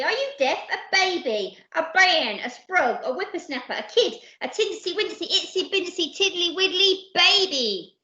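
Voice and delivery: put-on high, piled-up list turning babyish; exasperated yelling. Percy to the disbelieving crew, high and exasperated. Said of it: "yelled Percy".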